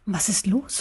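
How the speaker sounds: soft, timid worry; anxious question. A narrator's reading. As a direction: ask it quietly, a touch afraid. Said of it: "fragte Wuff ängstlich".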